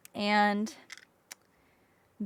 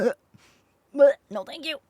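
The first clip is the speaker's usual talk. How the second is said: disgusted; 3 semitones higher than usual. Retold or imitated. Disgust at medical stuff.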